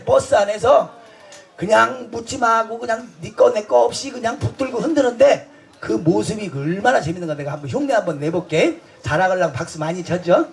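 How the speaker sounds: ordinary talk, nothing marked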